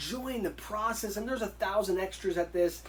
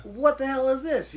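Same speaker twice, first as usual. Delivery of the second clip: loud, higher exclamation of disbelief; amazed outburst; 7 semitones higher, 6 dB louder than usual. Retold or imitated. Boxer recalling his shock at landing a Rocky film, amazed. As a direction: blurt it out, louder and higher.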